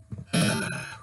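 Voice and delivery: breathy exhale letting the tension go; long sigh. Real, not acted. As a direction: let out a tired sigh to calm down. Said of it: "Got a little excited this morning".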